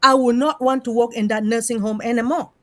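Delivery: normal talking voice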